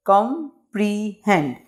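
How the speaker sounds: Comprehend as com, pre, hend with gaps; three spaced beats on comprehend; broken into syllables. Shown as a demonstration. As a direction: pause after each syllable of comprehend. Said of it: "इसको pronunciate कर लेते हैं".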